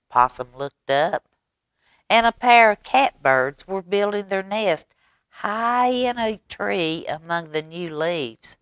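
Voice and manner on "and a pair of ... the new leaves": ordinary delivery, nothing marked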